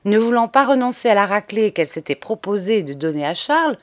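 ordinary delivery, nothing marked

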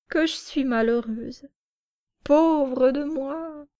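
slow, drawn-out wail of self-pity; lamenting.

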